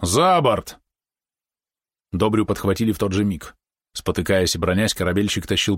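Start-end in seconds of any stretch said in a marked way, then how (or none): 0.0-0.8 higher, ringing order; booming command. A narrator's reading. Merchant orders the captive thrown overboard, booming command. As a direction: call it out like a skipper's command. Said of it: "скомандовал зычно".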